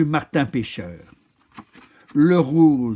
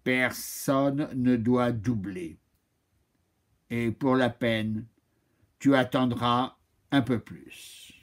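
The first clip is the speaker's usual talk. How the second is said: calm stern oak; 3 semitones lower, 4 dB quieter, slower than usual. Acted.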